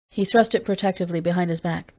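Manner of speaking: ordinary delivery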